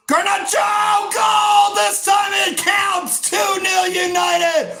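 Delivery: huge high shout of a goal call; goal celebration shout. Real, not acted. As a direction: scream the goal as it goes in. Goal scored, commentator erupts.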